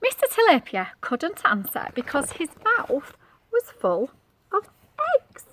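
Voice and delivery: ordinary delivery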